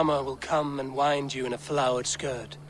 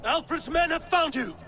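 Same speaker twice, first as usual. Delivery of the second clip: much higher, urgent shout across the hall; urgent call; 12 semitones higher than usual. Acted. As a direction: warn him before it's too late. Urgent warning called out.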